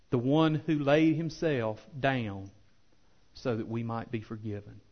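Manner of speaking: normal talking voice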